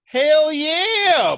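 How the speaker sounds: high, loud, hyped; excited exclaim. Real, not acted. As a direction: shout it out with glee.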